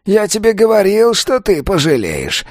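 high-pitched reproach; scolding old man. A narrator's reading. (ворчливый старик, высоким голосом)

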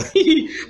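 high-pitched mock wail; hammed-up "hiii" of a baby's cry; imitated baby crying. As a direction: whine like a crying infant. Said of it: "elle est là comme ça".

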